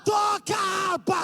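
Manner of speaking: high, loud preacher's shout; shouted command. Acted. Preacher shouting at the musicians, high loud command. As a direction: shout it to the church. Calls to play the harp.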